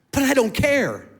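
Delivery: high and loud, overcome with emotion; defiant exclaim. Real, not acted. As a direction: throw the line out with joy. Defiant joyful emphasis.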